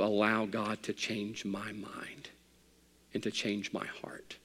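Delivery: slow, whispered plea; soft intimate sermon whisper; hushed aside. Acted. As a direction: draw them in with a whisper. Preacher drops to intimate whisper.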